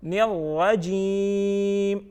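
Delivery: الرجیم stretched on a level note; slow chanted الرجیم with a very long ī; recited by parts. Shown as a demonstration. Teacher giving children the fourth part, slowly chanted. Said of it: "یہ ہم نے by parts پڑھ لیا".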